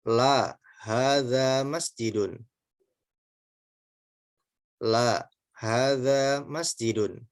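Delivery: careful read-aloud for the class to repeat; teacher modelling Arabic phrases slowly for students; slow model reading. Shown as a demonstration. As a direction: read each Arabic phrase slowly and clearly, pausing for repetition. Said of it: "saya bacakan dulu, silakan diikuti".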